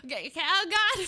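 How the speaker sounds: high, loud mock panic; freaking out. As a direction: get worked up in exaggerated alarm. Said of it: "So I'm freaking out".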